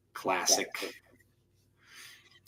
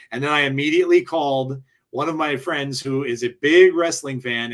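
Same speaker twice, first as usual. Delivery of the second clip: ordinary talk, nothing marked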